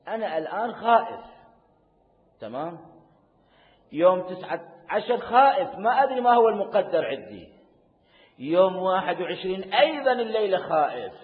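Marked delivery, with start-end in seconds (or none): 3.9-11.1 loud, high anguished confession; fervent preaching. Real, not acted. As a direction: cry out the fear to the crowd. Preacher confesses fear loudly.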